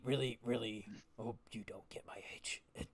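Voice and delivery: put-on conspiratorial old-man whisper; hushed half-orc aside. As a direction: breathe it out as a secret to the mercenary. Old orc confides to darling.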